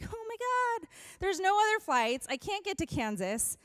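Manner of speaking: high, alarmed "Oh my God"; retelling the moment of panic; panicked oh my God. Retold or imitated. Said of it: "And I'm like".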